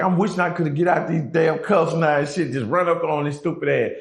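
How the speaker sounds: usual speaking voice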